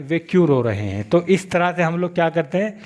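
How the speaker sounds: ordinary talk, nothing marked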